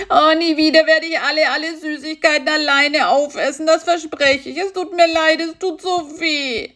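high, wailing promise in pain; whimpering. A narrator's reading. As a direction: whine and plead through the toothache. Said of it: "dem jammernden Karl-Heinz".

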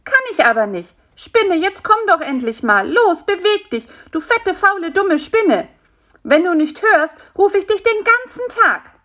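high, loud little fly scolding; petulant, high-pitched tirade; nagging clamour. A narrator's reading. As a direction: nag shrilly at a lazy spider. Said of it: "ging das Gezeter".

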